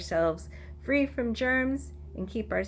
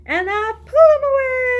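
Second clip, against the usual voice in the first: high, loud squeal on away; squealed climax; 11 dB louder, faster than usual. Shown as a demonstration. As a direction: burst up high and loud as the hands come free. Climax of action song.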